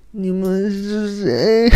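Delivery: very high, very slow coy falsetto; high, slow seductive "who are you". Retold or imitated. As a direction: tease the agent coyly. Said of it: "妩媚撩人的声音".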